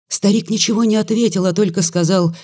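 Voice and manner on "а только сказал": usual speaking voice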